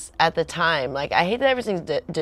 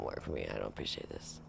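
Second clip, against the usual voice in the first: much softer, lower muttered "I don't appreciate this"; muttered repeat; 19 dB quieter than usual. Retold or imitated. Girlfriend repeating her complaint to trainer boyfriend, soft low mutter. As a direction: grumble the line under breath.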